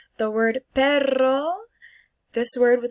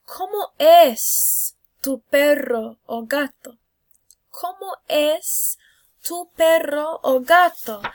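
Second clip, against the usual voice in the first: slow exaggerated question; 5 semitones higher, 8 dB louder, slower than usual. Shown as a demonstration. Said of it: "teacher asks class".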